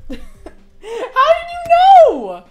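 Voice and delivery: very high, stretched squeal through laughter; laughing disbelief. Real, not acted. Streamer squealing at chat through laughter, high and slow. Chat guessed his next level.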